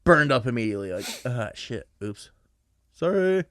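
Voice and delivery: ordinary talk, nothing marked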